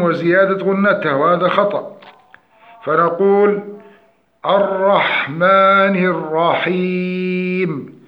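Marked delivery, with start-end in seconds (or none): 4.5-8.0 slow recitation of الرحمن الرحيم, mim without extra nasal hum; correct mim. Shown as a demonstration. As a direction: draw out الرحمن الرحيم, keeping each mim balanced. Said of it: "المحافظة على صفة التوسط في الميم". (تلاوة بطيئة بميم متوسطة دون زيادة غنة)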